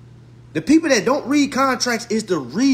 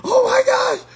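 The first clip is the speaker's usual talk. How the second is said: laughing disbelief; 6 dB louder than usual. Real, not acted.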